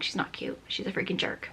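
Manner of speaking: unvoiced annoyed whisper. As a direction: hiss a fed-up reply under the breath. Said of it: "I'm like".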